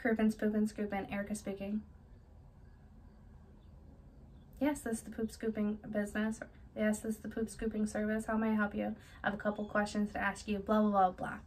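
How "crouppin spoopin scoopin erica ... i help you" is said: softer, unhurried professional answer; calm phone greeting. Shown as a demonstration. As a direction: greet the caller cool and collected. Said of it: "You want to seem as calm, cool, and collected".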